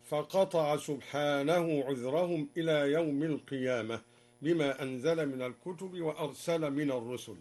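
ordinary delivery

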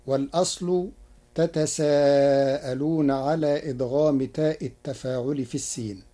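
ordinary talk, nothing marked

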